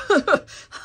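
high, loud burst of laughter; sudden disbelieving cackle; incredulous laugh. Real, not acted. Incredulous laugh at character's cruelty.

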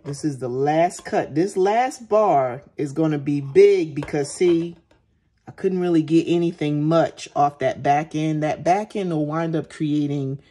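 ordinary delivery

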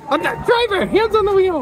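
a pretend rider begging the wild driver; mock panicked passenger. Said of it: "angry road rage driver".

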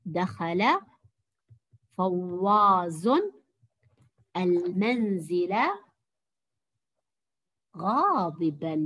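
very slow, word by word, with long pauses; slow dictation. Shown as a demonstration. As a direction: repeat the dictation slowly so they can check. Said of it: "سوف أمليكم عبارة بسيطة". (إملاء بطيء مع وقفات طويلة)

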